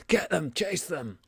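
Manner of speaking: a guard yelling after the fleeing party; guards shouting. Acted. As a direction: call out the chase order. Guards shout during the chase.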